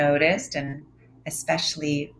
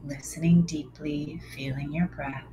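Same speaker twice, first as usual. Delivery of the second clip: whispering. Acted. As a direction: breathe the words close and hushed. They whisper in your ear.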